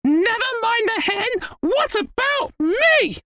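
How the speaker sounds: very high, indignant screech; attention-demanding old woman shrieking from a rooftop; grandma's shout. A narrator's reading. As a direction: screech out, dragging the last words. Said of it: "Grandma shouted from the rooftop".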